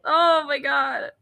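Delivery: high, slow, laughing through the words. Real, not acted. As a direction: crack up mid-sentence. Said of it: "look how sad his face looks".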